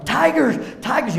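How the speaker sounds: put-on high, loud cry of a frightened little girl waking at night; child yelling in fear. Daughter waking at night, a loud scared yell. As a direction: yell it like a scared kid in the dark. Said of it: "she'd wake up at night yelling".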